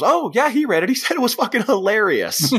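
high, animated, tactless gush; retold breezy enthusiasm. Imitating the roommate telling Rachel, gleefully tactless. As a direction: blurt it out high and delighted.

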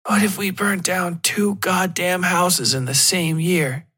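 smoke-ruined voice, slow and strained; croaking. Acted. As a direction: force it out through a raw throat. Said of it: "I croaked".

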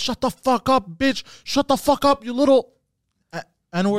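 retold furious online rant; imitated yelling. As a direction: bark insults like a toxic gamer. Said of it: "He's yelling at the kid".

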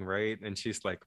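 usual speaking voice

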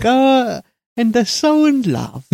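high, gushing, lovestruck sigh of a voice; swooning Stroheim imitation. Podcaster imitating Stroheim gushing over lovers, high and swooning. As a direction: coo it like a sentimental old director. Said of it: "the guy who made Greed was like".

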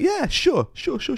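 high hammed-up voice of a lazy official waving it through; mock FIA official. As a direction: wave it through in a high mocking voice. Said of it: "the F I A going".

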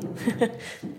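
short nervous chuckle; nervous laugh. Real, not acted. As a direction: laugh it off, a little uneasy. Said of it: "I'm laughing nervously now".

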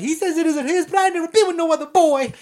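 high, loud put-on girl's plea; mock plaintive protest. Pregnant girl defending herself to principal, mock plaintive protest. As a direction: protest your innocence in a high, pushed voice.

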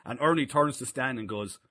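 ordinary talk, nothing marked

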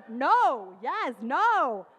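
loud, drawn-out, emphatic cheering; imitated emphatic refusal. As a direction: relish each word, long and loud.

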